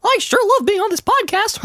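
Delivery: very high squeaky cartoon mouse; cheerful high falsetto mouse; Mickey Mouse voice. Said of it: "We can do Mickey".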